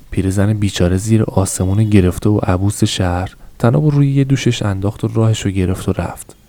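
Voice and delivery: ordinary delivery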